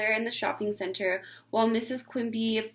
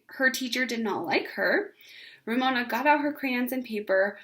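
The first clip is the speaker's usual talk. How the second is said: usual speaking voice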